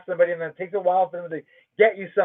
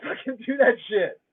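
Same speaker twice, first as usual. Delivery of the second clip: usual speaking voice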